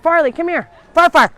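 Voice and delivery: real call-out to a pet; calling a dog. Real, not acted. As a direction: call the dog over, loud and high. Calling her dog over.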